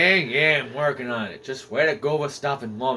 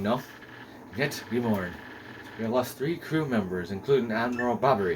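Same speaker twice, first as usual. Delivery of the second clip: lower, solemn castaway log reading; journal narration; 4 semitones lower, 5 dB quieter than usual. A narrator's reading. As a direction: read the shipwreck diary with gravity. Reads castaway journal entry.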